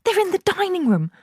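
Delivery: very high, tense voice; frightened mother. A narrator's reading. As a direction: hiss the news in fear. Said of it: "hissed the mother".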